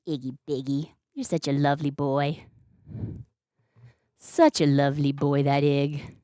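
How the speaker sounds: soft, low murmur to a beloved cat; tender cooing. Real, not acted. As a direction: coo affectionately, low and quiet.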